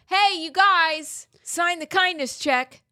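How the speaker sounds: slow, high, loud put-on call-out; rally the neighbors in a drawn-out mock voice; hammed-up pledge-pusher, pitched high; mock neighborhood busybody. Mimics imagined pledge organizer rallying neighbors.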